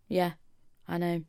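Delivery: ordinary delivery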